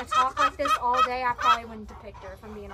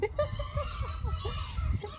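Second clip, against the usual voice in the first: high laugh; 12 semitones higher, 5 dB quieter than usual. Real, not acted. Laughing at goat antics.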